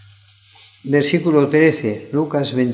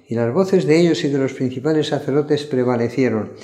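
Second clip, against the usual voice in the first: usual speaking voice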